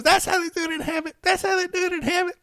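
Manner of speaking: put-on high, breezy girlfriend voice; girlfriend catchphrase. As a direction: quote her line in her high voice. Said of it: "her thing was always".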